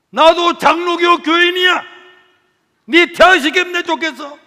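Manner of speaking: high, loud scolding rant; angry teacher. Retold or imitated. Teacher scolds the boy for skipping the exam, yelling. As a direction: rage and plead at once.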